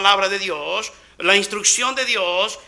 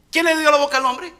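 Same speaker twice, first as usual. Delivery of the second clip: ordinary delivery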